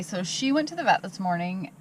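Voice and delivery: usual speaking voice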